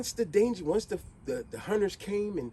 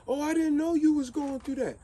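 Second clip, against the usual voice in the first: mocking excuse; 10 semitones higher, 3 dB louder than usual.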